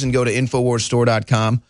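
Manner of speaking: ordinary talk, nothing marked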